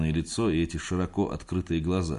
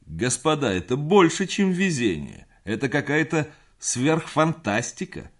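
booming voice; 8 semitones higher, 4 dB louder than usual. A narrator's reading. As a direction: boom it out to the room. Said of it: "громовой голос Густава".

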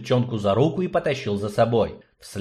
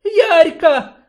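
high, loud call across a crowd; shouting a name; 8 dB louder than usual. Acted. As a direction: yell her name to catch her attention. Friend calls her name in a crowd.

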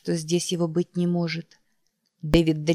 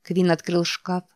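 plain reading voice

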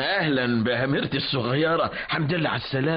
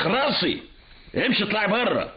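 raised, angry father ordering her out; 5 semitones higher than usual. Acted. Father to rude daughter: get out, angry. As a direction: throw her out of the house.

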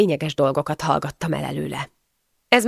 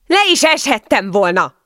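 raised, higher indignant voice; indignant scolding; 5 semitones higher, 5 dB louder than usual. A narrator's reading. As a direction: call after him in outrage. Furious wife chasing husband. (felháborodott szemrehányás)

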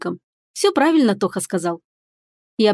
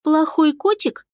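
put-on high, questioning little girl; a small girl asking; 6 semitones higher, slower than usual. A narrator's reading. Girl asks.

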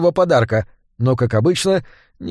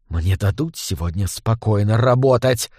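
restrained roar; 5 semitones lower than usual. A narrator's reading. Gang boss fed up with interruptions, muted roar. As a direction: growl it low in exasperation. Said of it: "взревел Кривой".

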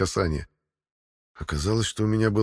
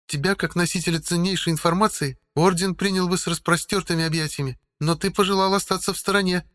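higher, stern warrior woman; female voice; 10 semitones higher than usual. A narrator's reading. Narrator voices Helga.

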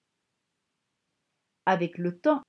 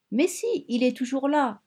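cried out; 5 semitones higher than usual. A narrator's reading. A girl touching her mother's belly, crying out high. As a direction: shout it up high like an excited little girl. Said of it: "avait-elle crié".

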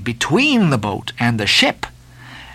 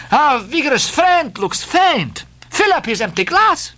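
hospitable Spanish host; 13 semitones higher than usual. Acted. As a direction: call for the glass to be filled. Don Pedro voiced, calling for drink.

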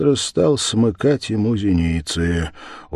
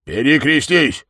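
raised, louder command; village head's order; 5 semitones higher, 6 dB louder than usual. Acted. Village head orders the suspect, loud and higher. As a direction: order it out loudly. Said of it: "сказал голова".

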